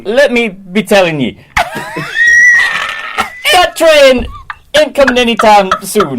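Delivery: put-on Jamaican accent. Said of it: "Continue this in a Jamaican accent".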